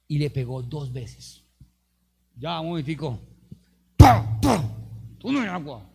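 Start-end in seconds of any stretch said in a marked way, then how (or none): 2.3-5.9 angry Moses. Retold or imitated. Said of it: "Pero Moisés, ¿qué hizo?".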